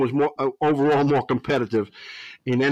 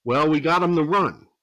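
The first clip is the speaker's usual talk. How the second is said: usual speaking voice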